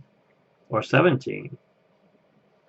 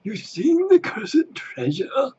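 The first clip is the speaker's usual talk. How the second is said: much higher, slow mock pirate; slower than usual. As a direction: squawk it like a parrot pirate. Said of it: "My favorite part on Pirates".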